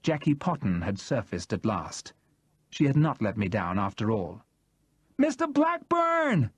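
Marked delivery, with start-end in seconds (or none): 5.2-6.5 much higher, slow shouted name; stretched-out shout; shouted again. Acted. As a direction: call out once more, insistent. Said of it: "she shouted again".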